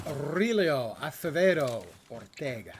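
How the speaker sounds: put-on Castilian accent with rolled R's; Castilian Spanish accent. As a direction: trill the R's, lisp the S's, Castilian. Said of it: "You gotta trill your R's, ... lisping the S's".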